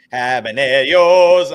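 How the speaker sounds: slow, loud mock Spanish accent on Habaneros; exaggerated Spanish accent, drawn out and loud; terrible Spanish accent. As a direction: ham up a bad Spanish accent on each syllable. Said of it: "It's a terrible Spanish accent".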